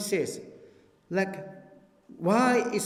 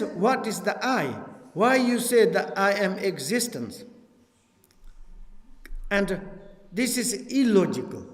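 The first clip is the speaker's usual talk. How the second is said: normal talking voice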